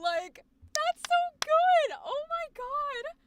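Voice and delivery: high, loud, drawn-out delight; gushing excitement. Real, not acted. Streamer praising the actor's take, squealing excitement.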